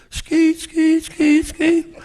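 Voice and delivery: high squeaky skeet skeet of a swinging bucket; bucket squeak imitation. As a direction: mimic the creaking bucket coming.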